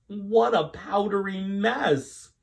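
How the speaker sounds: high, loud and slow, fed up; exasperated exclamation. Acted. Missus Greenberg over the flour spill, slow dismay. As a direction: drag it out in dismay.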